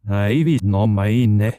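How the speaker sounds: slow, loud, garbled backwards Scottish; backwards Scottish accent. Acted. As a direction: speak slowly in reversed-sounding Scottish. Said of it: "spoke in sort of backwards Scottish accent".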